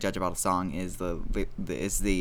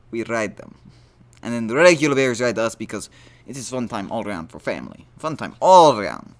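hammed-up Russian accent, higher and louder; 4 semitones higher, 5 dB louder than usual. Podcaster joking to listeners about war crimes, in a hammed-up Russian accent. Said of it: "that's my Russian impression".

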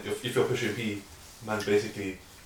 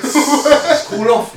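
laughing; 13 semitones higher, 12 dB louder, much slower than usual. Real, not acted. Laughing high squeal.

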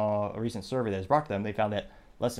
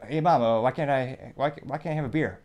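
put-on higher, louder whiny child's plea; pestering kid; 4 semitones higher, 4 dB louder than usual. Kid pestering mom for a tattoo, whiny and higher. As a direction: play the nagging little kid.

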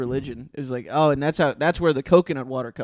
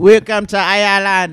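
slow, louder mock Irish greeting; mock Irish accent; 2 semitones higher, 8 dB louder than usual. Friend joking about islands, mock Irish welcome. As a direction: welcome them in like a jolly Irish host. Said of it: "talks like that".

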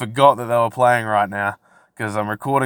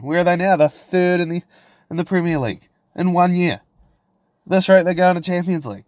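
higher, fast excited rant; 6 semitones higher than usual. Real, not acted. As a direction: gush like a hyped-up fan. Gushing about Newcastle's rise.

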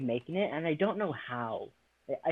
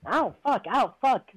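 louder and higher, real ouch cries; pained yelps; 8 semitones higher, 7 dB louder, slower than usual. Real, not acted. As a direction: yelp each time the cat bites. Cat biting her arm.

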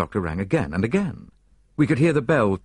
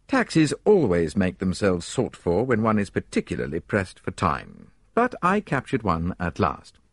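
usual speaking voice

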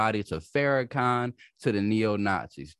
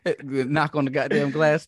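usual speaking voice

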